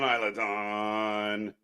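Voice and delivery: low, soft, very slow Isla Dawn; fading reverent name. Real, not acted. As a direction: breathe the name out in awe. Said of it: "savouring the name, slowing".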